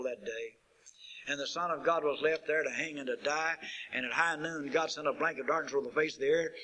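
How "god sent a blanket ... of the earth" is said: ordinary talk, nothing marked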